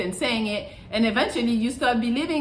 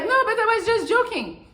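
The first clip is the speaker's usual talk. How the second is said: high, mocking voice of a girl brushing it off; mock girlfriend; 11 semitones higher than usual. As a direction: mimic her fake innocence, way up high. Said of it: "they're like".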